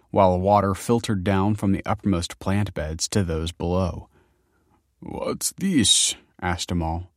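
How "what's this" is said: put-on querulous old man, rising high; confused old man. A narrator's reading. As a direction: ask suspiciously, as a frail old man. Confused old man asks about food.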